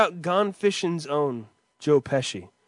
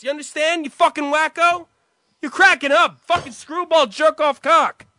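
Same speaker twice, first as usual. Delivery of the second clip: high, loud, furious insults; acted screaming rant; 10 semitones higher, 6 dB louder than usual. Brother storms out yelling insults, furious. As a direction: unload on him at full volume. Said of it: "End scene".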